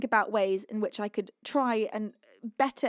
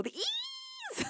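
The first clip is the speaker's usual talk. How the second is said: squeaky thanks; 8 dB quieter than usual. Real, not acted. Playful greeting to friend Matt.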